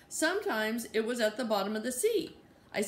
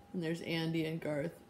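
ordinary delivery, nothing marked

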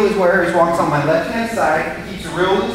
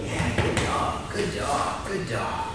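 whispered praise; 2 semitones higher, 8 dB quieter, much slower than usual. Real, not acted. Quiet praise to dog.

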